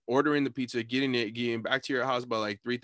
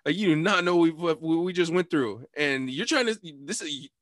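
raised-pitch retold pleading argument; argumentative protest; 5 semitones higher, 4 dB louder than usual. Teller argues with the Uber driver, worked up. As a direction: plead the case, building louder to the end. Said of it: "I'm argumentative at this point".

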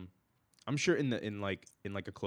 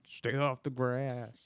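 put-on slow, drawn-out old-man holler, higher pitch; angry old man; 4 semitones higher, 4 dB louder, much slower than usual. Playing an angry old man on the porch chasing people off, cranky. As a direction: put on a grumpy old man's drawn-out warning. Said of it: "that angry old man on the porch".

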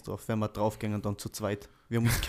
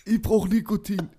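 much higher, louder breathless wheeze; hyperventilating; 13 semitones higher, 9 dB louder than usual. Retold or imitated. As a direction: gasp like you can't breathe. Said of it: "ich krieg keine Luft mehr".

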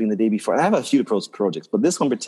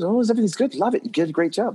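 mock praise, much higher; pleased partner; 10 semitones higher than usual. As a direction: play the happy partner, lifting up high. Partner voiced in retold story.

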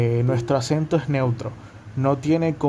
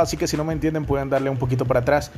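rushed talking; much faster than usual. Real, not acted. Podcast host rushing through his intro at full speed. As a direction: rattle it off very fast. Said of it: "Hoy ando embalado".

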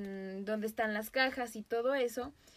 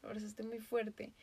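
calm flat verdict; 8 dB quieter than usual. Retold or imitated. Mom telling her daughter the duck is dead, softly. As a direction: break the news quietly. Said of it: "Mi mamá dijo".

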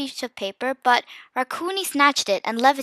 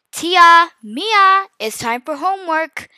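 louder, higher calling-out on the names; dad's reminder; 5 semitones higher, 7 dB louder, slower than usual. A narrator's reading. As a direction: call the kids to homework like a dad. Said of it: "Dad reminded".

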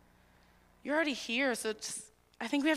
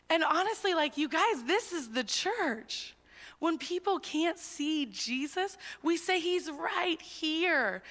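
passionate preaching; 7 semitones higher, 3 dB louder than usual. Real, not acted. Preaching climax, raised voice.

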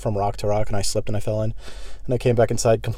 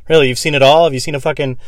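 ordinary delivery

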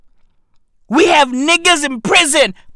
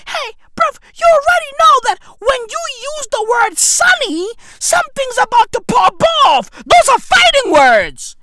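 mock wailing rant; 10 semitones higher than usual. Ranting about the word sunny.

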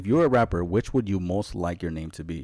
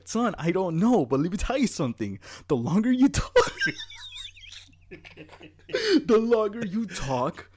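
laughing; 12 semitones higher, 4 dB louder than usual. Retold or imitated. Dad's wise reply, laughing.

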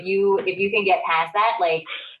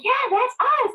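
much higher chirpy "That's us"; mock perky women. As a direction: voice the women brightly pleased. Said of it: "And they're like".

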